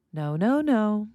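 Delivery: sing-song warning on "No, no, no"; varied intonation of no. Shown as a demonstration. Parent talking to children, warning "No, no, no". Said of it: "Listen to the different ways".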